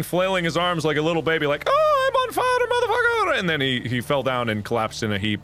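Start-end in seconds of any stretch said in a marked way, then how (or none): 1.7-3.4 very high-pitched mock scream; retold high panicked shriek; imitated screaming. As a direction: scream it like a flailing baby. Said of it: "he came out screaming".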